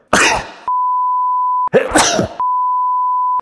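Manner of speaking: loud real sneeze; a sudden, full sneeze; sneezing. Real, not acted. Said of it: "E eu agora vou espirrar".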